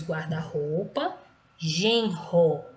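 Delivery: "guarda-roupa, genro" slowly, R as a strong H-like sound; teacher's slow, separated "guarda-roupa, genro" with strong R; strong R mid-word. Shown as a demonstration. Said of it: "também vai ter um som forte".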